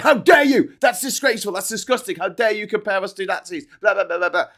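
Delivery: high, loud mock indignation; mock outrage. Mocking the department's press release, loud and outraged. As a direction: ham up the official outrage. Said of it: "they went".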